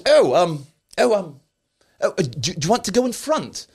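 high, hammed-up posh lady stammering; flustered posh mother. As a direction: play the caught-out posh mum fumbling for words. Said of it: "your pretend posh accent there".

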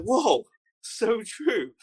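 high, slow "whoa" of sudden sympathy; mock-awed agreement. Taliban fighters won over by Wales talk, slow mock awe. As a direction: draw out the amazement, hammed-up.

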